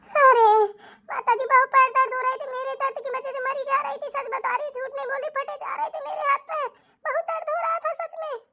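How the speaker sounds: put-on high, pleading young wife voice; whiny wife. Wife pleads with her mother-in-law in a high whine. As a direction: whine your innocence, hammed up.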